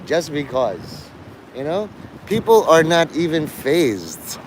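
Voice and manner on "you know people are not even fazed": usual speaking voice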